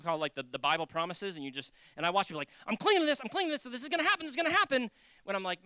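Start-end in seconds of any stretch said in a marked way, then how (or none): 2.7-4.9 high, loud, hurried chant of belief; hammed-up anxious clinging, repeated fast; mock desperate insistence. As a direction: imitate someone frantically convincing themselves. Mimics people clinging to promises.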